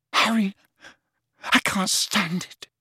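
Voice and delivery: high, strained confession; anguished. Acted. Lovesick lad confessing to his friend, anguished.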